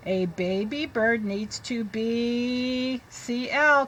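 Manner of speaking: very slow, careful read-aloud; teacher reading to children. Shown as a demonstration. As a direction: read it slowly for the class.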